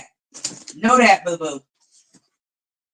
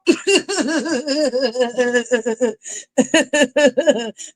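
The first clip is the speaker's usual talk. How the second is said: laughter; 4 semitones higher than usual. Real, not acted. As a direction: let a big laugh roll out. Big laugh after shouting.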